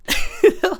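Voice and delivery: sudden high giggle; high squeal laugh. Real, not acted. Vlogger laughs at own retort, high squeal.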